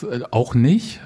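ordinary talk

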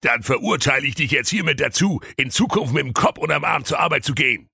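hammed-up royal shout; furious king. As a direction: pronounce it shouting like a mad king. King passes sentence.

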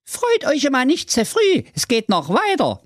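very high teasing son; youngest son voice. Acted. Youngest son warning his brothers, very high. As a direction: warn them smugly. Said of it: "sagte der Klaus drei".

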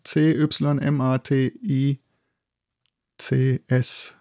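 "C Y M A T I C S" spelled letter by letter, slowly; slow letter-by-letter spelling of "C Y M A T I C S". Shown as a demonstration. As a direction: spell "C Y M A T I C S" out one letter at a time. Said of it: "ist Cymatics".

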